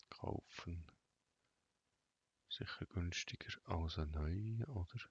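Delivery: ordinary talk, nothing marked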